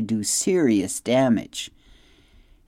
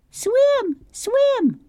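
much higher, tiny squeaky chirp on each swim; squeaky gosling; 4 dB louder than usual. A narrator's reading. Gosling Bright Bill chanting at his mother, tiny high squeak. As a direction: peep swim swim like a baby bird.

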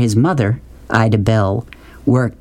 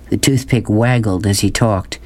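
usual speaking voice

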